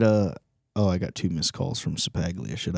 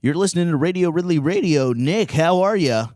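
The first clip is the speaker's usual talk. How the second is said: radio host voice; 8 semitones higher, 4 dB louder than usual. Pretend. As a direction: welcome the caller like a DJ. Fake radio show intro.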